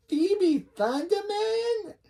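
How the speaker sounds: put-on very high, drawn-out Chinese-accent screech; mock Chinese accent. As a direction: stretch each word high in a hammed-up Chinese accent. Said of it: "Missus Wong does not have this accent".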